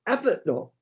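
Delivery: a hint of a posh telephone voice, pitch lifted. Shown as a demonstration. Author starting his book reading in a light posh voice. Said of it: "I naturally went to put on a posh voice".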